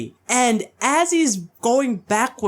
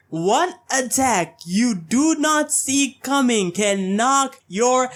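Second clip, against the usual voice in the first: ordinary talk, nothing marked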